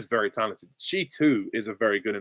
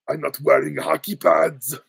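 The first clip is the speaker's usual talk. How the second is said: put-on thick Austrian accent, pitched up; Arnold Schwarzenegger; 4 semitones higher, 4 dB louder than usual. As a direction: bark it as an Austrian action-hero vigilante. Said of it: "Arnold as Batman".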